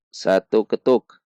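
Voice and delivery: ordinary talk, nothing marked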